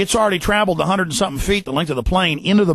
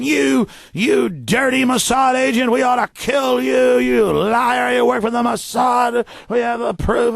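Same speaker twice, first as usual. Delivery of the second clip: higher, slow angry mock yelling; mock accusing rant; 6 semitones higher, slower than usual. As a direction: rant at him like a furious accuser. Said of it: "folks that come up to me going".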